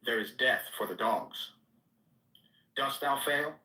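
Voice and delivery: usual speaking voice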